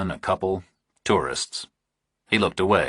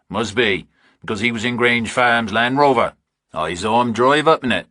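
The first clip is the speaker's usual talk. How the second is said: lightly voiced farmer; 5 semitones higher, 3 dB louder than usual. Narrator voices a rural farmer.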